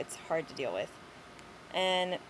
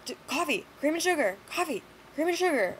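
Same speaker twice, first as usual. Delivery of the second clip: high, louder imitated ranting; mock yell; 8 semitones higher, 3 dB louder than usual. Customer yelling his order again, high mock yell. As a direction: repeat it like an agitated man. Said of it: "yelling in my face".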